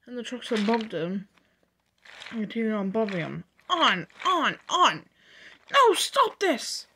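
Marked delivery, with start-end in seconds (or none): 3.7-5.1 loud, higher chant; urging shout. Acted. Trucks bumping Oliver down the line, shouting. 5.7-6.9 very high, loud, drawn-out protest; protesting shout. Acted. Milk tanker protesting as trucks bump Oliver, shouting.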